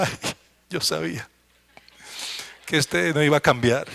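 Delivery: a hint of bitter disappointment; discouraged wife's voice.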